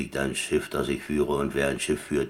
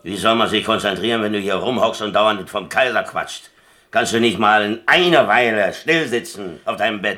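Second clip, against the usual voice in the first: louder, higher exasperated telling-off; fed-up, raised voice at a chatterbox; irritated scolding; 5 semitones higher, 7 dB louder than usual. Acted. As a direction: snap at him to finally sit still. Scolds roommate distracting his chess.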